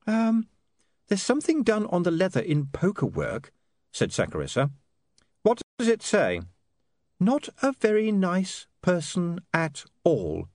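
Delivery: put-on hesitant young woman reading aloud; Saccharissa's voice. A narrator's reading.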